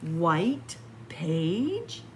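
ordinary delivery, nothing marked

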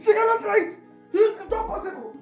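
high-pitched, hammed-up horror; over-the-top wailing indignation; outraged disbelief. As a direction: cry out in scandalized disbelief. Horrified at Opa being thrown away.

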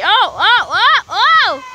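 loud rising oh oh; excited shouting. Real, not acted. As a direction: shout through the ride. Riding zip line.

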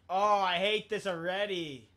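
whiny complaint. Real, not acted. Annoyed gamer complaint.

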